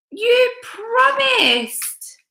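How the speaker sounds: very high, very slow wail; hurt little boy. A narrator's reading. Little boy protesting the wolf's broken promise, very high and slow. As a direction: drag it out, crushed.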